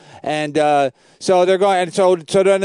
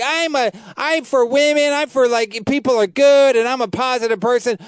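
higher, mock-earnest preachy voice; mocking Pete Davidson; 8 semitones higher than usual. Mocking Pete's fake niceness to the hosts, mock-earnest voice. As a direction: sneer it like a fake nice guy. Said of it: "and he's like".

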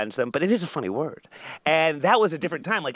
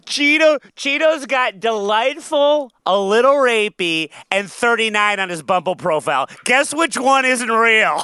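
hyped-up announcer; 6 semitones higher, 6 dB louder than usual. Pretend. Mock dating profile pitch.

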